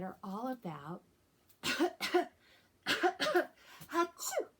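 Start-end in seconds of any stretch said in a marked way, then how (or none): 1.6-2.3 loud mock cough; a hammed-up fake cough; pretend cough. Teacher faking a cough for kids, loud mock cough. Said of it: "those were just pretend coughs". 3.9-4.5 loud, high mock sneeze; a big hammed-up fake achoo; pretend sneeze. Teacher acting sick for kids, loud mock sneeze. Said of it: "pretend sneezes".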